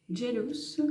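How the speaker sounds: English brand word in a French-tinged English accent; attempted English accent from a French speaker; self-mocked English accent. Real, not acted. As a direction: say it with a proudly imperfect English accent. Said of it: "Avec mon magnifique accent anglais".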